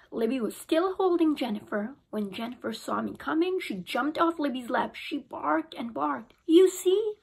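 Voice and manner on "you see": higher, louder alarmed cry; startled child crying out; cried out. A narrator's reading. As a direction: cry it out like a scared kid. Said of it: "I cried".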